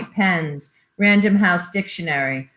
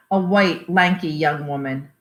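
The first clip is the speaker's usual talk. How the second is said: plain reading voice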